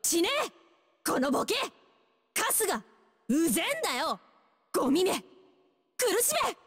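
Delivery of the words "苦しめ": high-pitched, venomous battle cry; vicious attack call. Acted. Rough fighter cursing an enemy, vicious attack call. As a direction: spit it out as an attack.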